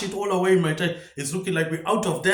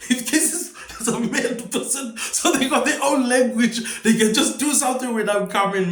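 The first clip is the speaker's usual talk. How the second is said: high-pitched words squeezed through laughter; laughing; 6 semitones higher, 3 dB louder than usual. Real, not acted. Comic warning of a mad person coming, laughing. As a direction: keep talking while cracking up.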